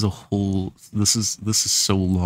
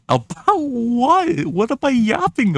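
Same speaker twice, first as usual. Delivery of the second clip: mock squeaky outrage; 3 dB louder, slower than usual. Mocking his own rambling story.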